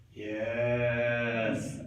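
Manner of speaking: low, drawn-out dopey "yes"; dim-witted friends. Acted. Friends failing to grasp his gnome theory, dim-witted. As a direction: answer like two dim pals, low and slow. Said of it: "That's the actual level their brains work at".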